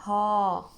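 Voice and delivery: ها with the "aa" held long; long vowel haa. Shown as a demonstration. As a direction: stretch the vowel of ها into one syllable. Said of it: "تتكون عندنا مقطع ها". (مدّ الألف)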